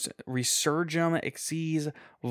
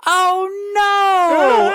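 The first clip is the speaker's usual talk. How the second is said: put-on high, loud little kid shout; toddler's cry; 10 dB louder than usual. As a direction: yell it like a scared toddler. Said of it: "my two-year-old son goes, at the top of his voice".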